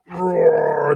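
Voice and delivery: a quick playful dragon roar. Acted. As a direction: growl it out like the dragon. Dragon in the battle.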